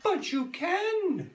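high, slow eager cry; princess voice. A narrator's reading. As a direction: cry it out hopefully. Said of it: "cried Princess Toadstool".

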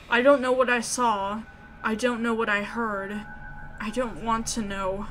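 normal talking voice

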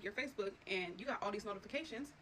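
ordinary delivery

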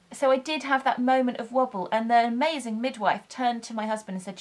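usual speaking voice

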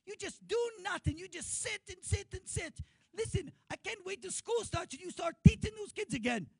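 high scolding wife voice, rising to shouts; a wife berating her husband for sitting all summer; nagging wife. Retold or imitated. High-pitched nagging wife scolding her husband. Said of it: "His wife comes along and says".

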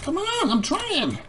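high, drawn-out frustrated whine; exasperated. Real, not acted. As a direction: whine in protest, dragging it out. Player pleading with the game.

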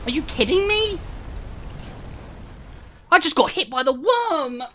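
high-pitched wail of protest; whiny complaining. Real, not acted. Speedrunner whose run just died, whiny protest. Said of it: "I probably sound like such a whiny baby".

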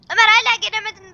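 loud, fast call to a friend; impatient call. Real, not acted. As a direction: hurry him impatiently.

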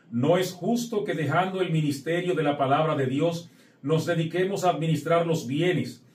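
ordinary delivery

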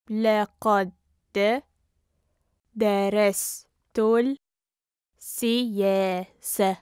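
syllable by syllable. Shown as a demonstration. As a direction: sound it out with long gaps. Teacher breaks phrase into syllables.

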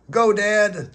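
high loud cheer. A narrator's reading. SpongeBob cheering his dad running the bases, a loud high cheer. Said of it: "cheered SpongeBob".

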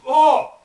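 loud scream of real pain. Real, not acted. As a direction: cry out from the burning. Wasabi burning his mouth.

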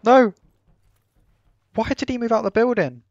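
high, loud horrified yell; shocked outburst. Real, not acted. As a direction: shout in sudden dismay. Just killed a friendly.

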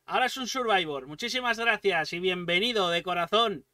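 ordinary delivery

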